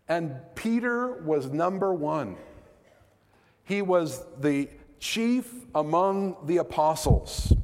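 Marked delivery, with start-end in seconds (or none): none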